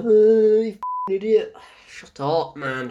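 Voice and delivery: loud, high, drawn-out exasperation; exasperated outburst. Real, not acted. Reviewer fed up with Dan's line, exasperated outburst. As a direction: groan it out loudly at an idiot.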